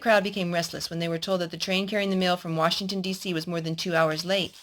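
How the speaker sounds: steady storybook reading voice; reading a story to a little boy; story narration. Acted. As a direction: read it like a picture book to a child. Adult reading a story aloud.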